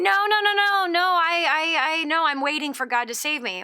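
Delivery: high, loud burst of no's, easing down; hammed-up stubborn protest; acted frantic refusal. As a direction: refuse help with mock urgency. Joke's man refusing third rescue.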